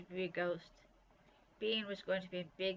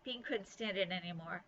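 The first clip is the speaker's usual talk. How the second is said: ordinary delivery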